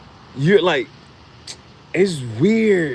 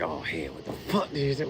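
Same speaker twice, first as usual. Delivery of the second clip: anxious mutter; 8 semitones lower, 11 dB quieter than usual. Retold or imitated. Quoted anxious inner voice.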